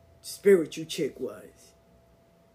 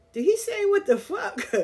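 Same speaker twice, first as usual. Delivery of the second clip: disbelief; 12 semitones higher than usual. Retold or imitated. Nephews react in disbelief.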